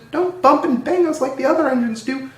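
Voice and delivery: high pleading carriage voice; coaches' voice. A narrator's reading. Coaches begging Edward to be gentle, high. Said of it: "said the coaches".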